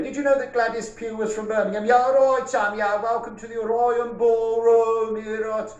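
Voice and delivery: mock Birmingham banter, ta-ra duck; light Birmingham accent, hammed up for laughs. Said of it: "This is my Birmingham accent".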